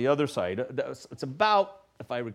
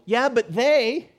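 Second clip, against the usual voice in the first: put-on high, slow sulky teen protest; mock teenage excuse; whiny teenager; 8 semitones higher, 5 dB louder, slower than usual. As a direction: drag it out like a sulking teenager. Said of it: "teenagers who go".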